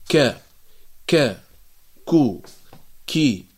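ك، كو، كي said as crisp separate syllables from the back of the tongue; kaf letter sounds. Shown as a demonstration. Teacher drilling students on the kaf sound. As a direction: tap out ك، كو، كي one by one. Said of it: "فنقول".

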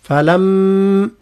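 "فلم" very slowly, the doubled م hummed through the nose; ghunna on م. Shown as a demonstration. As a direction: hold the nasal ghunna of the م in "فلم". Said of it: "المد متاع الغنة". (غنة الميم في "فلم")

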